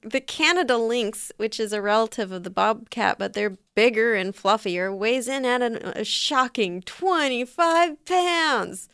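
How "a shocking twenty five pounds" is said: mock shock. Mock-dramatic reveal of a weight.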